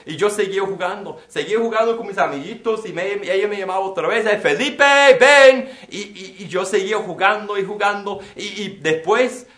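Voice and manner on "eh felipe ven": put-on loud, high call to a distant child. Mother calling Felipe again, loud high call. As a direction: shout his name across the street.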